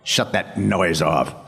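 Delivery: slow, deliberate low order; Wolverine. Retold or imitated. Tough pool player sick of the TV, slow menace. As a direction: drag out a tough-guy command. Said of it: "looks up at the screen and says".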